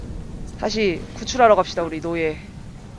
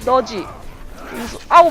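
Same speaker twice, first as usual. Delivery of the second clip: normal talking voice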